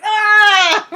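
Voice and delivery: high loud startled scream; mock scream. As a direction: shriek and bolt. She screams and runs off.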